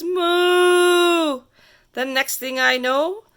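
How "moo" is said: long, higher, louder moo; cow moo. Acted. The cow looks at the kid and moos, loud drawn-out moo. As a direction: moo out like a big cow in the road. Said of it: "and the cow says".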